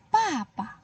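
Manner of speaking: papa said slowly and clearly. Shown as a demonstration. Teacher repeats the Mandarin word for dad, clearly. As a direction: model papa for the class. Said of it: "Bahasa Mandarinnya ayah".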